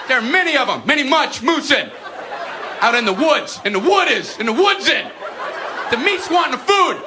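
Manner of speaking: rambling dim student. Acted. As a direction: babble with growing confidence. Brian rambles nonsense answer.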